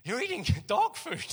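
wife laughing, telling Matisse he's eating dog food. Retold or imitated. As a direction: burst out laughing at the punchline. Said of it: "his wife comes out and goes".

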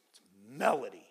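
put-on low, deflated kid reply; a touch of hurt pride; miffed girl. Offended camp girl tells the pastor her name, low and deflated.

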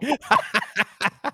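high burst of laughter; helpless laughter. Real, not acted. Laughing at own slip with co-host, helplessly. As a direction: crack up completely.

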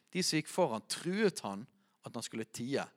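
ordinary talk, nothing marked

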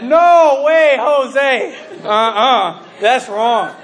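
loud, drawn-out mock refusal; stubborn refusal. Student shown repentance, loudly refusing. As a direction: imitate a student digging his heels in.

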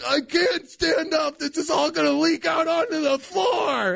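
put-on high, panicked kid whine. Boy refusing his mom, whining in high panic. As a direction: plead in a frantic high whine. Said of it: "I'm like".

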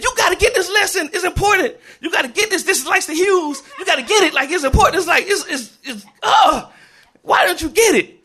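loud, high-pitched exasperated pleading; snapped teacher. Retold or imitated. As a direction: plead with the class at the end of your rope, voice way up. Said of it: "I just snapped".

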